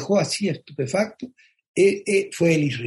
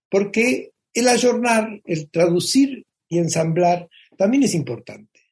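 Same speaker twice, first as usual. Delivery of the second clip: ordinary talk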